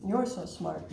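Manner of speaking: ordinary delivery, nothing marked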